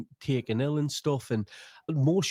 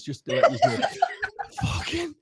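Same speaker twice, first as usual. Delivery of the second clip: laughing. Real, not acted. Punchline through laughter.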